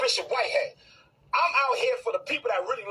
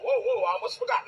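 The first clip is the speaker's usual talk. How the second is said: ordinary talk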